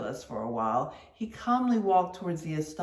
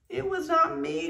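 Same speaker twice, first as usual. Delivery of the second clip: high, frightened protest; crying out; 12 semitones higher, 3 dB louder than usual. A narrator's reading. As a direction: squeal out the denial. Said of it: "cried Doughboy".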